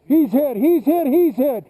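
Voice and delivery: loud high urgent yell; man down shout. Real, not acted. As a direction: call out the hit to the team. Teammate hit during game.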